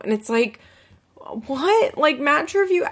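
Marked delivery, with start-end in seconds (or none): 1.2-2.9 high-pitched, baffled outburst; incredulous. Real, not acted. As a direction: react in disbelief, pitch jumping up. Reacting in disbelief.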